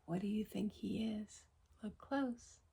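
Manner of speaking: soft whisper to the listeners; a secretive hushed aside. Real, not acted. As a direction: lean in and whisper the question.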